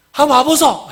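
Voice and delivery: loud, high Gyeongsang dialect call. Shown as a demonstration. Preacher demonstrates Philip's invitation in Gyeongsang dialect. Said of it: "경상도 말로 하면 뭐예요?".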